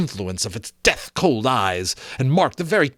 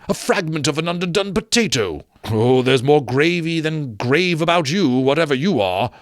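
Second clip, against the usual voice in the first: higher, brisk nervous banter; Scrooge forced jokes; 6 semitones higher than usual. Acted. Scrooge cracking jokes at the ghost to steady himself, nervous banter. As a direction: joke to hide nerves.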